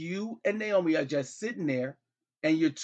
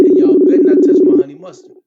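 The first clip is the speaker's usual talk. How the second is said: mock warning; 6 semitones lower, 17 dB louder, faster than usual. Mock threat over dipping sauce.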